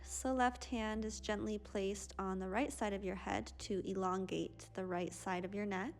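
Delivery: ordinary delivery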